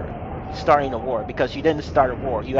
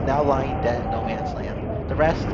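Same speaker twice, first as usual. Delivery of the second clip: usual speaking voice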